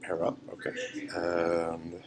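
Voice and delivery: ordinary delivery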